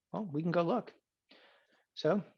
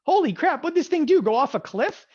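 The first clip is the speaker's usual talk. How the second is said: high, loud mock astonishment; shocked mechanic; 9 semitones higher, 7 dB louder than usual. Body shop mechanic seeing the wrecked car, loud mock shock. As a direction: gasp at the wreck, hammed up. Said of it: "they're gonna be like".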